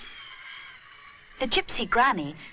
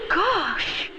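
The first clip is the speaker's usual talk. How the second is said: shaky gosh; 11 semitones higher, 4 dB louder than usual. A narrator's reading. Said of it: "thinks Rupert shakily".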